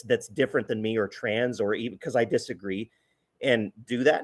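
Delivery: ordinary delivery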